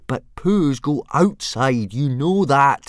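slow, loud, high exasperation; boy losing patience with his dragon; at the end of his tether. A narrator's reading. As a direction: patience completely gone. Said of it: "at the end of his tether".

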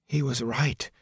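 soft, breathy and awed; breathy whisper. A narrator's reading. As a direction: breathe it out quietly in awe. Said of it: "Egwene breathed".

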